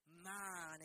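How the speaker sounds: ordinary talk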